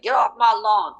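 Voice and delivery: put-on grumpy old man yelling off his porch, pitched high; cranky old man snapping at neighborhood kids. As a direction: shoo kids off the lawn like a cranky geezer. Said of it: "that crazy old man on his stoop".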